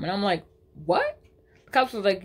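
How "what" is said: high, squeaky disbelieving what; shocked yelp of disbelief; stunned what. Real, not acted. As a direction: react in total disbelief. Cops say she kidnapped daughter.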